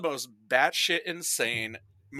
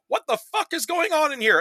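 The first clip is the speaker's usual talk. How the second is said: a hint of an indignant old lady, pitched much higher; lightly voiced old lady; 13 semitones higher than usual. Old lady walking in on a chase, mock indignant. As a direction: bark it like a scandalized granny. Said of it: "her one line for the movie is".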